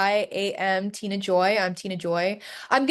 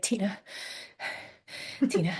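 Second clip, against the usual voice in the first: hushed sighing call; 9 dB quieter than usual. Retold or imitated. Dad calling late at night.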